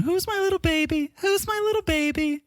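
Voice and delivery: mimicking a very high cooing baby-talk voice; high-pitched baby talk. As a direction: squeak it like baby talk, far above your own voice. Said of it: "It's a high-pitched voice".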